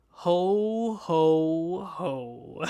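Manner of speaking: loud, booming ho ho ho that fades away; booming Santa laugh. Retold or imitated. Vlogger plays a mean mall Santa, a loud booming ho ho ho. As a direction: laugh like a smug Santa pushing the kid off. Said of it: "and he says".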